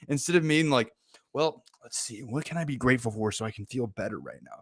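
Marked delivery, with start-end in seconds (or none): 1.3-4.6 mock-earnest, softer musing to oneself; too serious. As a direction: act out overly serious deliberation. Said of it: "a little bit too serious about it".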